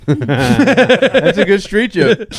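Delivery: loud, high, drawn out through laughter; laughing hard. Real, not acted. Friend reacting to a street joke, laughing hard. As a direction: crack up while talking, loudly.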